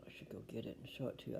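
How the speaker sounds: fast whispered aside; whispering. Real, not acted. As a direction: whisper it quickly. Aside to viewers.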